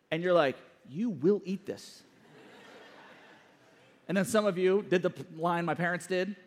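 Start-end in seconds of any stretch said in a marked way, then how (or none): 0.9-2.0 low, slow, measured order; stern parent. Acted. Parent answering a child who refuses dinner, stern and slow. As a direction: lay down the law slowly, without shouting.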